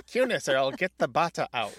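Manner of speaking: loud stern teacher voice; stern teacher threat. Retold or imitated. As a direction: threaten the kids with the stick. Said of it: "a teacher in Ireland might shout".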